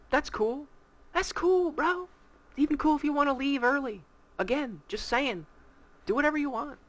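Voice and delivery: very high, airy it's-all-good voice; hammed-up laid-back bro; mock chill. Mock-chill remark to late guest.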